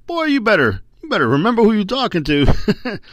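high, mock-stern warning; mock-stern grandpa. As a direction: play up the scolding. Grandpa scolding the boy.

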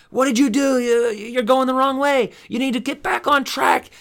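hammed-up Marine shout at wife; mock yell. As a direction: yell it in her face like a Marine. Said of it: "yell in her face and say".

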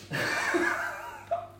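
high, soft burst of laughter; real laugh. Real, not acted. Speaker cracks up after calling someone the leader, laughing. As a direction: let out a quiet, high-pitched laugh.

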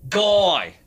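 name called in a high mock-Australian voice; imitated Australian accent. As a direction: say it as a big drunken Australian would. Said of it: "I just got suckered by the accent".

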